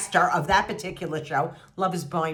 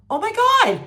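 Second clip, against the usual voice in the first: much higher and louder shocked cry; shocked exclamation; 14 semitones higher, 6 dB louder than usual. Retold or imitated. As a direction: explode in disbelief. Retold shocked reaction, escalated.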